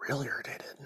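breathy, exasperated whisper; irritated whisper. Real, not acted. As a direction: hiss it under your breath, fed up. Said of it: "So I'm getting".

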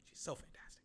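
breathy whisper of admiration. Real, not acted. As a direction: whisper it adoringly. Whispered adoration.

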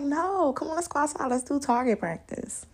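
high, pleading invitation to come shoot; eager coaxing. Pretend. Coaxing her man into target practice, high and eager. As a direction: bounce on every word to drag him outside. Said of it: "I'd be like".

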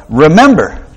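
"Remember" called out loud and high, held long; emphatic scripture quote. Shown as a demonstration. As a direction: open the quote by ringing out "Remember". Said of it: "Remember is a command".

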